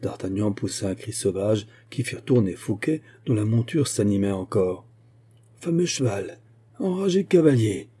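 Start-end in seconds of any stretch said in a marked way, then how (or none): 5.6-7.9 raised, high-pitched angry growl; growled exclamation. A narrator's reading. Captain growling at the horseman he is chasing. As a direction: growl it out, pitch leaping on the second half. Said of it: "gronda le capitaine".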